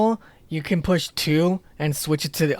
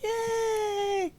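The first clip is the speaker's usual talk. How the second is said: very high excited squeal; 5 dB quieter than usual. Retold or imitated. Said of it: "I was like".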